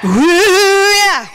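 high, soulful whoop; inner soul sister. Retold or imitated. Speaker showing off the inner soul sister, high soulful whoop. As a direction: belt out a high, drawn-out "yeah". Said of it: "channel my inner soul sister".

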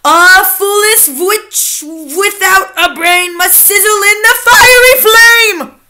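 slow, loud, rising witch chant; raging witch recital. A narrator's reading. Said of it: "The Grand High Witch went on".

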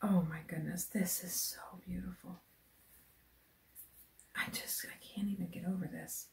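whisper, too moved for full voice; awed whisper. Real, not acted. Overcome by the yarn from Jude, whispering.

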